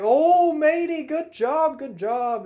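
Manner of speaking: high, loud hollered praise; cheering friend. Retold or imitated. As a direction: whoop congratulations from down the dock. Friend cheering from far away.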